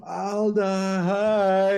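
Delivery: high, bright-eyed fake delight; fake pompous greeting. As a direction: gush over her with fake charm. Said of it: "putting as much of the pomp".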